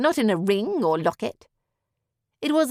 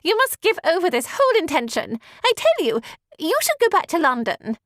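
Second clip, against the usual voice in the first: high, haughty lady voice; insistent Miss Bingley; 8 semitones higher than usual. A narrator's reading.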